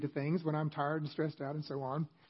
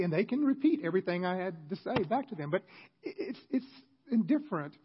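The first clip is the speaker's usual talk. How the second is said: usual speaking voice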